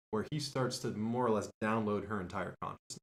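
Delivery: usual speaking voice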